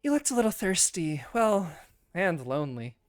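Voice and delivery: lilting character voice, gently flirting; flirtatious wood elf. Acted. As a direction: offer company with a teasing invitation.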